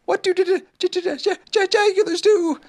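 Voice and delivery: high, stuttering little Piglet; frightened stammer. A narrator's reading. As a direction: stumble nervously on the first sounds, pitched high. Piglet nervously asks about jagulars.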